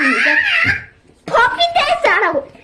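high loud exasperated order; yelling. Real, not acted. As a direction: yell the order. Yells order at sibling.

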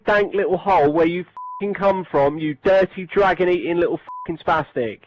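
normal talking voice

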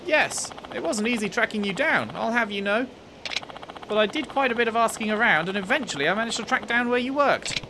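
high-pitched headmistress character voice; former headmistress. Acted. Voices former headmistress in game dialogue.

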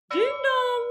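ding dong bell toll; loud bell call. Acted. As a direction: ring it out loud and high. Hyacinth bells ring out.

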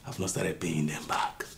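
low bitter whisper; cold whisper. Acted. Husband to wife about his parents, cold whisper. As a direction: hiss it out coldly.

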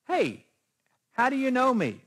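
high, loud startled question; surprised Nathanael. Acted. Preacher acts out Nathanael surprised at Jesus knowing him, loud and high. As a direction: Nathanael caught off guard, asking how he's known.

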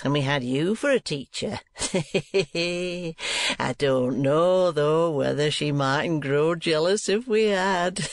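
slow, drawling jest with a chuckle; chortling schoolmaster Squeers. Acted. Schoolmaster joking with the niece, drawling and chuckling. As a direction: drawl it out, tittering at your own joke.

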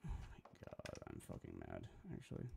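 low, soft muttered fury; fuming under your breath; quiet seething. Real, not acted. Streamer muttering to himself after yelling at Photoshop, low and soft.

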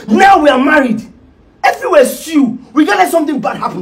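high, loud reproach; worked-up, pressing complaint; heated accusation. Acted. Accuses partner during quarrel.

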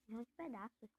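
ordinary talk

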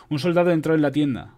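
ordinary delivery, nothing marked

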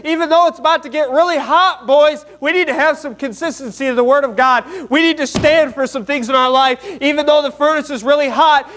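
high, insistent sermon delivery; full-force preaching. Real, not acted. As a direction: exhort the congregation without letting up.

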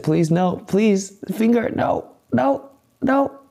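anguished denial. Pretend. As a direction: plead in hammed-up rising panic. Finger about to end stream.

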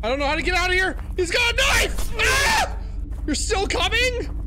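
high, loud terrified shouts; panicked screaming. Real, not acted. Gamer chased by a knife man, screaming high.

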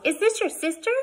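put-on excited little girl's chatter, high and loud; Sudie. A narrator's reading. Child questioning Boston Jane about the newcomer, excited chatter. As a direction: gush like an eager small child. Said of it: "trying to slow the rush of words".

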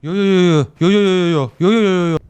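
rapid string of alarmed no's; alarmed refusal. Real, not acted. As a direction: blurt the no's in repeated bursts. Alarmed refusal during the game.

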